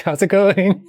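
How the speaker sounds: bubbly hello to the makeup team; sunny, giggly how's it going; bright cheery greeting. Retold or imitated. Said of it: "filling it with brightness and joy".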